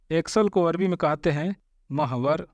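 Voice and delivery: ordinary talk, nothing marked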